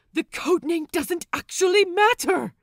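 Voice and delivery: loud high exasperated yell; shout. Acted. Lucius yelling to refocus the crew, loud shout. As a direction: shout them back to order. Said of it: "Lucius shouts".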